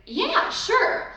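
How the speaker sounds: cheerful actor voice, lightly put on; easy agreement. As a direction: toss off an agreeable yes. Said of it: "they should be able to vocalize".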